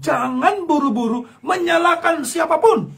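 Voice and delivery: full-force pulpit exhortation; preaching shout. Real, not acted. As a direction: thunder the warning.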